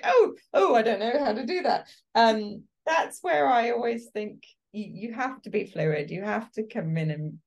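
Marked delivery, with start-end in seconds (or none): 0.0-1.9 high, loud, fast mock panic; a flustered actor thrown by a note; panicked actor.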